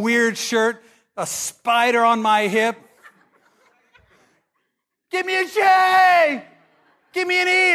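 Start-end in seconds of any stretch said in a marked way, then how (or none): 5.1-7.7 high, slow, shouted cheer chant; mock cheerleader shout. Teen cheering Jesus letters at school, hammed-up chant. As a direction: chant it like a pep rally cheerleader.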